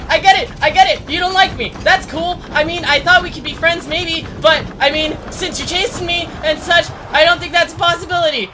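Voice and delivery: fast, high, loud sarcasm; sarcastic panic. Real, not acted. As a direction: babble at the monster in fear. Talking to the chasing monster.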